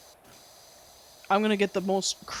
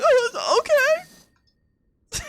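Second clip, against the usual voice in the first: very high, small defeated voice; backing down with a squeaky okay; meek squeak; 6 dB louder, much slower than usual. Retold or imitated. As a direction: shrink into a tiny squeak. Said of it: "And I'm like".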